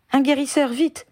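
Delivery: appel aigu et pressant; hurlement. A narrator's reading. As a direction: hurle à l'aide dans le hall. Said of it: "hurla-t-il". (Harry hurle pour un guérisseur)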